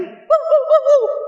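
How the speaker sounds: high yapping dog bark; sharp high woof woof. Retold or imitated. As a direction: bark like a little dog at the door. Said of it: "gonggong di luar pintu".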